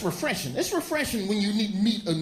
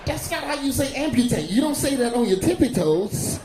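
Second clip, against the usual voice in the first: exasperated correction; 6 semitones higher than usual. Acted. Correcting the doctor.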